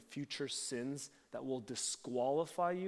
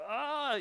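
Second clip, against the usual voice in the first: startled yelp; 13 semitones higher, 6 dB louder than usual. Retold or imitated. Peter panics on seeing Jesus.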